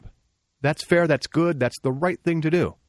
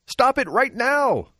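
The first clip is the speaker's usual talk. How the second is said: mock alarm; 11 semitones higher, 3 dB louder, slower than usual.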